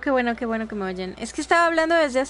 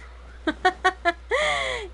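laughing; 5 semitones higher than usual. Real, not acted. Losing in a boss fight.

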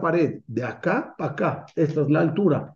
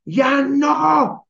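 fed-up parent; 12 semitones higher, 4 dB louder than usual. Acted.

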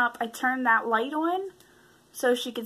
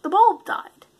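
high and loud, matter-of-fact; mock brain voice; 8 semitones higher, 8 dB louder than usual. Her brain insisting the bulb just died, raised and loud. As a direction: announce it like a dumb inner voice. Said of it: "my brain was like".

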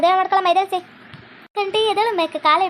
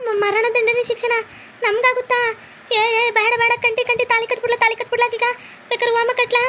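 a panicked plea, voice jumping up high; panicked begging; 6 semitones higher than usual. Acted. Son panics before policewoman, begging in a high frightened voice.

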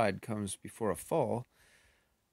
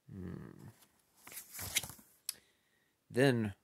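plain reading voice

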